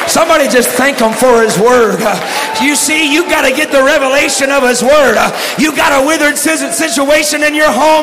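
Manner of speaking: chanted, rhythmic preacher's call with an "uh" before each line; preaching at full force. Acted. Preacher urging the church to speak the word, shouting. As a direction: drive every line home like a revival preacher.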